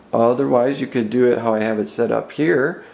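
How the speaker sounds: normal talking voice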